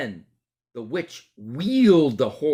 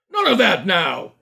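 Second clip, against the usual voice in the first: louder, higher stern rebuke; 7 semitones higher, 8 dB louder than usual. Acted. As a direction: scold the suspect. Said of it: "said the policeman sternly".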